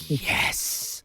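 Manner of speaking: hushed, voiceless mock "yes"; playful whispered cheer; secretly pleased. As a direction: whisper it like a secret little celebration. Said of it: "secretly, were you like".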